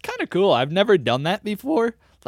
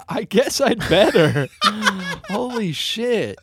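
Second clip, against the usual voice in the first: laughing; 5 semitones higher, slower than usual. Real, not acted. Still laughing after the video.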